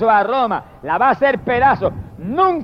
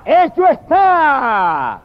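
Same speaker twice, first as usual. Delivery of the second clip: slow, booming proclamation; drawn-out heavenly decree; mighty voice; 5 semitones higher, much slower than usual. Acted. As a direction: declare it like a voice from the temple. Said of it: "una voz poderosa del templo que gritaba".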